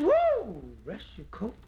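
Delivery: high, loud squealing whoa; a woman marvelling at the suitor's coat; woman's whoa. Retold or imitated. Woman impressed by his coat.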